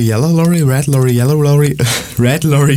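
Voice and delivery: ordinary talk, nothing marked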